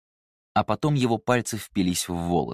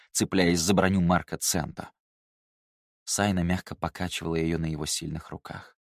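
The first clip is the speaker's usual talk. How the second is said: ordinary delivery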